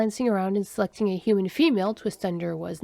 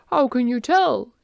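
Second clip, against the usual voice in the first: slow, louder curious question; interested question; 4 semitones higher, 5 dB louder than usual. A narrator's reading. Alien asks human about spoiled food, interested. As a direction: ask with open interest. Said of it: "Twist Under asked with interest".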